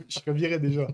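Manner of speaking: usual speaking voice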